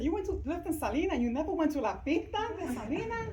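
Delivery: high, loud, rapid retold questioning; hammed-up astonishment, voice swooping up and down; mock disbelief. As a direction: imitate an incredulous friend. Acts out friend's disbelieving question.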